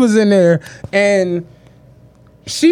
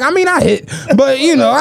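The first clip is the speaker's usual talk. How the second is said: laughing quote; 7 semitones higher than usual. Retold or imitated. Friend's sheepish admission, laughing.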